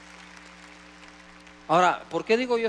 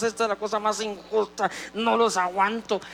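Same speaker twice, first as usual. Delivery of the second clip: mock angry; 3 semitones higher than usual. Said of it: "Se ponían enojados, enfadados".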